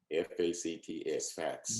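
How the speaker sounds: letters F A C T S spelled one by one, then facts. Retold or imitated. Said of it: "if you present facts to people".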